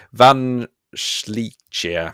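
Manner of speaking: names read slowly in a mock German accent. Shown as a demonstration. Teacher reading scrambled names in a German accent. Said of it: "I always do it in a German accent".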